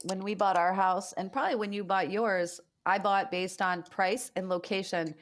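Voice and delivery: ordinary talk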